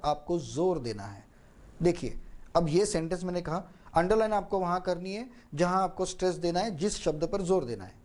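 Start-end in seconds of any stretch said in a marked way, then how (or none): 1.8-8.0 rushed fast run-through of the sentence; fast version that nobody can follow. Shown as a demonstration. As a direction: rattle it off quickly without pauses. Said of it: "अगर मैंने ये fast कहा होता".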